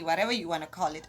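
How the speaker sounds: ordinary talk, nothing marked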